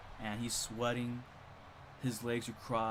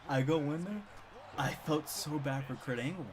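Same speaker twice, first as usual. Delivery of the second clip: usual speaking voice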